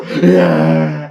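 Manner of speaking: loud mock cheer; crowd cheer. Friend imitates the crowd cheering, loud. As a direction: cheer like the whole room.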